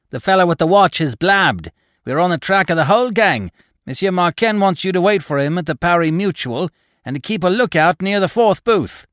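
high, urgent, hurried report. A narrator's reading. Man brings the inspector word of the gang, hurriedly. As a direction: rattle off urgent news. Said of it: "said to him hurriedly".

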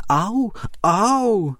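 high pained ow, ow; sharp cry of pain. A narrator's reading. Larch tree yelps at the ant bites, high pained cry. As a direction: yelp as if bitten hard. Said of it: "Tentokrát to bolelo více".

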